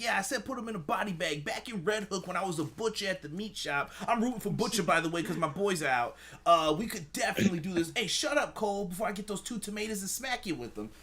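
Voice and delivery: lightly voiced Taz with a Brooklyn accent; a hint of Taz's Brooklyn accent, ranting; Taz impression. Host doing a Taz Brooklyn-accent rant at Cole. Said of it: "seamlessly transitions into that accent".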